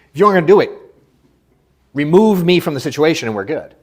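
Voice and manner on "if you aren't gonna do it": fast and louder, laughing through it. Real, not acted. Laughing as he tells agents to keep him out of it. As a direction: laugh the words out quickly.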